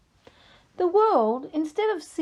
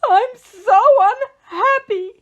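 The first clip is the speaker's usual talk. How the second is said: high, loud, slow howl; howling wail; 12 semitones higher, 13 dB louder, slower than usual. Acted. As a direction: wail it out like a little kid. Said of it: "he howled".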